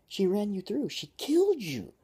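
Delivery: high, loud outraged cry; shouting. A narrator's reading. Crew member protesting to the captain, shouting. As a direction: shout it in shock, stretching the last words. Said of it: "shouts Uta".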